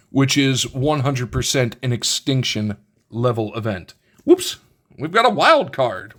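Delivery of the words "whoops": high startled yelp; surprised whoops. Real, not acted. Card slips, surprised yelp.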